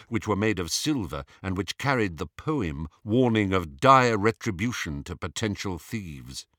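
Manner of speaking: ordinary delivery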